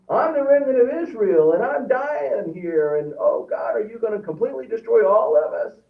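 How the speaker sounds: high, loud pleading wail of a doomed man; self-pitying leader. Retold or imitated. Dying leader pleading with God, high wailing voice. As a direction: whine that you're the chosen one. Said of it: "he thinks he's talking about himself".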